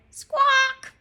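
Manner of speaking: very high, loud bird screech; parrot squawk. Acted.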